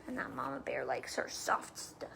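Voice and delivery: soft whispered aside. Acted. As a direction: whisper it like a secret. Aside about mama bear.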